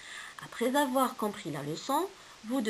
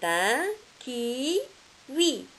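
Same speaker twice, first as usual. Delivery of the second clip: slow, careful "kiwi" for the class; slow model word; 3 semitones higher, much slower than usual. Shown as a demonstration. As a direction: model "c'est un kiwi" very slowly. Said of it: "teacher models the word".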